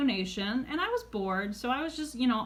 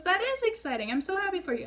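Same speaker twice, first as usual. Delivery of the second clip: high-pitched imitation of an excited mother; delighted mom; 9 semitones higher, faster than usual. Mom hearing about daughter's date, retold delight. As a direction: act out a thrilled parent's congratulations. Mom's delighted reply, acted out.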